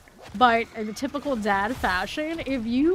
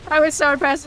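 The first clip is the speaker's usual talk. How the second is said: higher, louder, I was so impressed; excited emphasis; 5 semitones higher, 8 dB louder, faster than usual. Shown as a demonstration. Telling viewers about dad's burger, higher and louder with excitement. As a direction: really sell how impressed you were.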